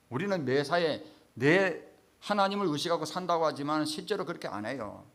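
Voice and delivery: usual speaking voice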